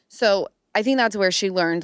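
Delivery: ordinary talk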